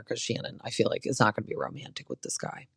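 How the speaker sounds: quick hushed aside, fast; whispered aside. Real, not acted. Recapper whispering her doubt about Shannon's date. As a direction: toss it off under the breath.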